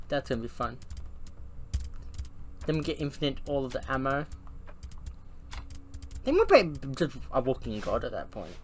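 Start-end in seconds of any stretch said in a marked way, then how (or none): none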